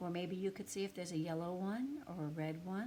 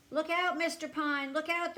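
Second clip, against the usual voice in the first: higher, louder warning shout; calling out; 9 semitones higher, 9 dB louder than usual. Acted. Boys warning Mister Pine on the ladder, loud warning call. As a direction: yell a warning up at him. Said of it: "the boys called".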